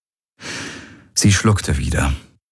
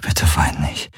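whispered; 3 semitones lower than usual. A narrator's reading. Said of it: "flüsterte er ihr ins Ohr".

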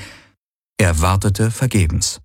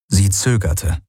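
usual speaking voice

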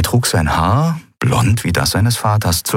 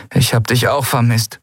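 plain reading voice

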